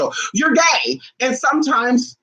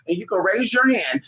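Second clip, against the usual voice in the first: normal talking voice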